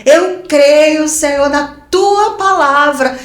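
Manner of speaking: clame a Deus em voz mais alta e aguda; declaração de fé. Retold or imitated. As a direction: declare com força, alongando as palavras. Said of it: "falando com Deus". (clamor de fé, em voz alta)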